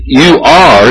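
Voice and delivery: "you are" pressed for the congregation; stress on you are. Shown as a demonstration. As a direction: give weight to "you are". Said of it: "is stressing the".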